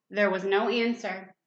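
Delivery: usual speaking voice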